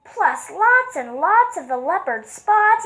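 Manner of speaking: high, loud, slowed panic; zebra's loud high yelp of alarm; yelling zebra. Acted. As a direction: yell it out in alarm. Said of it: "The zebra yelled".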